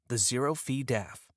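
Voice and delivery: ordinary talk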